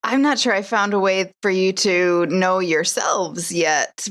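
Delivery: ordinary talk, nothing marked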